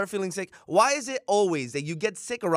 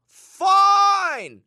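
long drawn-out high Fine, much louder; swooning fine; 10 dB louder than usual. Real, not acted. Gushing to friend about actress: drawn-out swooning Fine. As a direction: swoon over the word like she's dazzling.

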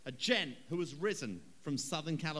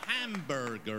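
mock American; 3 semitones lower, slower than usual. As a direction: lower mock American drawl on Hamburger. Mock American word again.

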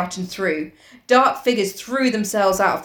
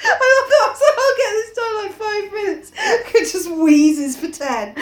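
laughing; 12 semitones higher than usual. Real, not acted. Laughing through lines.

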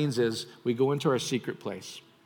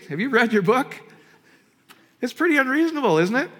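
higher, louder taunting skeptic voice; mock skeptic; 12 semitones higher, 9 dB louder than usual. Preacher voicing a scoffer to the congregation, loud and taunting. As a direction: sneer it out like a doubter.

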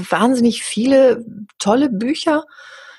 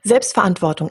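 ordinary talk

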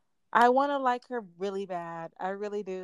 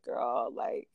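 higher, drawn-out lament; mock heartbroken plea; 5 semitones higher, much slower than usual. Fan addressing the singer who let them down, wounded whine.

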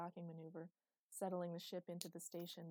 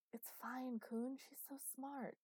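whisper; 7 semitones higher, slower than usual. Acted. Hushed reply over child's head.

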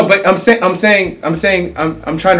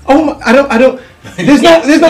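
laughing, caught without an answer; exasperated laugh, higher; flustered laugh; 9 semitones higher, 5 dB louder, faster than usual. Real, not acted. Stumped, laughing under pressure.

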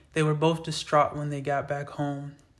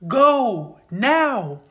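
loud, high yelled command; urgent shout; 9 semitones higher, 10 dB louder than usual. A narrator's reading. Dying father yelling at the boys to flee, urgent shout. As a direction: scream it at them to run. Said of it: "With intense urgency in his voice".

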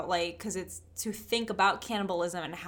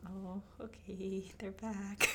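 much softer, slow, eerie murmur; spooky hush; 10 dB quieter, slower than usual. Pretend. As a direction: spook your friend with a hushed line. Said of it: "You're freaking me out".

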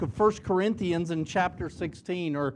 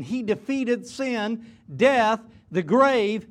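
slow words, grave louder and punched; slow emphatic build; 3 dB louder, slower than usual. Acted. Preacher proclaiming victory to the church, slow rising emphasis. Preacher climaxes on resurrection point.

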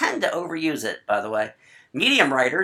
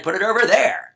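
emphatic exclaim; 6 semitones higher, 4 dB louder than usual. Shown as a demonstration. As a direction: shout it out with a raised pitch. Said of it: "Exclamation point, exclamation point".

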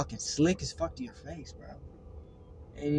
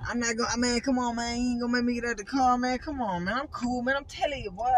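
higher-pitched mock pleading friend; imitating friend; 7 semitones higher than usual. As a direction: whine it up high like a pleading buddy. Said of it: "let me do it more".

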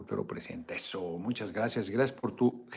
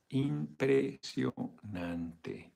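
drawn-out; 4 semitones higher, much slower than usual. Real, not acted. Drawn-out admiration.

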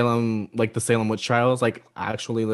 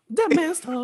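much higher, laughing witch voice; 14 semitones higher than usual. Retold or imitated. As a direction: squeal it like a giddy witch. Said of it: "She goes".

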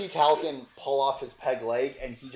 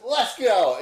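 higher, louder rallying cry; rowdy call; 7 semitones higher, 7 dB louder than usual. Acted. GM voicing Hope dragging a player away. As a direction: shout it while hauling him off. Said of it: "and goes".

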